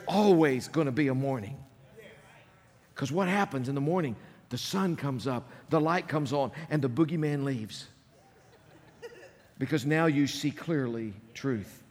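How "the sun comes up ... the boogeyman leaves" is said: ordinary talk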